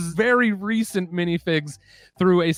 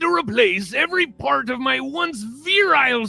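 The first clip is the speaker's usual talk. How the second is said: higher, hammed-up gruff pirate rant; pirate Metalbeard; 7 semitones higher than usual. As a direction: growl it like a salty old pirate captain. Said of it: "Nick Offerman doing a pirate".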